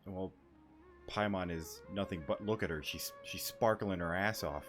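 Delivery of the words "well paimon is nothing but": ordinary delivery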